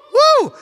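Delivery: high, loud whoop of joy; celebratory whoop. Real, not acted. Preacher cheering with the church, loud whoop. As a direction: let out a party cheer.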